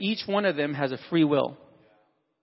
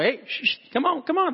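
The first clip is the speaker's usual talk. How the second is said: high coaxing call to a dog; 8 semitones higher than usual. Pretend. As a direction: coax the dog over, voice up high. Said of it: "You go".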